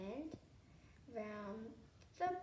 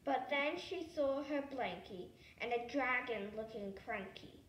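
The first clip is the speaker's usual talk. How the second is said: ordinary delivery, nothing marked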